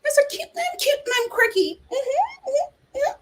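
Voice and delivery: put-on high, coy girlish voice; mock cutesy voice. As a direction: play it cute and quirky. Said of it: "her way of being".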